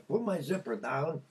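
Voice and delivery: ordinary talk, nothing marked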